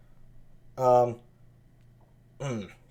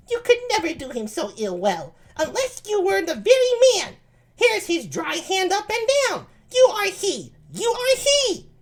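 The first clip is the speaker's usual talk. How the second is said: much higher falsetto lady voice; high-pitched Ursula. Acted. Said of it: "I need a high-pitched voice".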